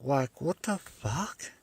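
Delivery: stunned, stretch it out softly; drawn-out baffled. Real, not acted. Repeats baffled reaction.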